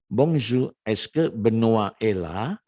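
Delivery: slow, careful French question with a rising end; slow reading. Shown as a demonstration. Teacher reads the dialogue slowly for students to repeat. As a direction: read "est-ce que Benoît est là" word by word. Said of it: "saya akan baca pelan-pelan".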